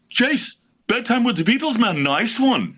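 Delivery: high, light imitation of Paul's voice; Paul impression. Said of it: "And he goes".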